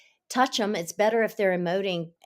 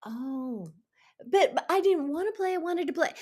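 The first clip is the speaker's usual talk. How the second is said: put-on upset child; 9 semitones higher than usual. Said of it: "And they're like".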